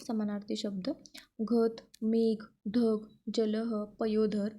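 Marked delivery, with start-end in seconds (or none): none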